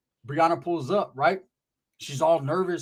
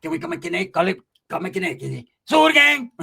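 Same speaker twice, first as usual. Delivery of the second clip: stoned Pepe. Retold or imitated. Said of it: "He's like".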